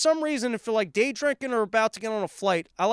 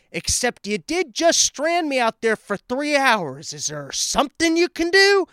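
strained politeness rising higher and louder to the end; forced polite request; 5 semitones higher, 3 dB louder than usual. Retold or imitated. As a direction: keep a polite smile while the pressure builds. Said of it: "smile at a person and go".

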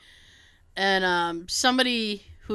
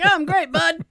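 higher, mock-chipper answer; breezy reply; 7 semitones higher, 4 dB louder than usual. Patient answering the chummy doctor, mock-chipper. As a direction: toss it back casually, matching his tone.

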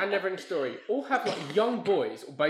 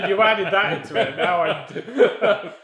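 loud, slow words through big laughter; real helpless laughter; 5 semitones higher, 10 dB louder, much slower than usual. Real, not acted. Co-host teasing about the tuberculosis joke, helpless laughing. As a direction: crack up while trying to talk.

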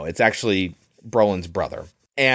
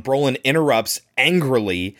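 normal talking voice

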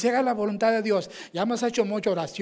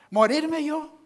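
incredulous; 7 semitones higher, much slower than usual. Retold or imitated. Incredulous reply about dying.

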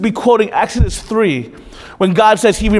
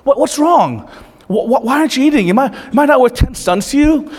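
higher-pitched mock-clueless husband, lightly voiced; Elkanah; 6 semitones higher than usual. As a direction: imitate a husband feigning puzzlement, stammering. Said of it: "he starts off by asking the question he already knows the answer to".

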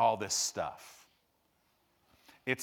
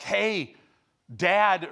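higher, louder child's call, acted; imitated calling out; 5 semitones higher, 6 dB louder than usual. Little kids alerting dad at the pool, calling out.

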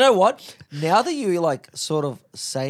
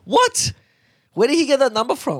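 surprised disbelief; 9 semitones higher, 6 dB louder than usual. Real, not acted. Friend hears the absurd price.

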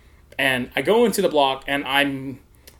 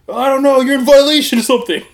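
loud, high-pitched officer imitation; mock officer voice; 12 semitones higher, 10 dB louder than usual. Officer telling prisoner of violation, hammed-up high voice. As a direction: blurt it out as a hammed-up cop. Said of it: "I hope he said it just like that".

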